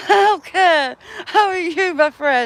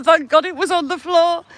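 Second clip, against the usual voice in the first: ordinary delivery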